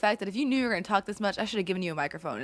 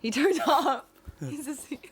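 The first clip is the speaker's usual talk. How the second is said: higher-pitched, breaking into laughter; laughing; 6 semitones higher, slower than usual. Real, not acted. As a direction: say it while cracking up. Cracks up repeating the joke.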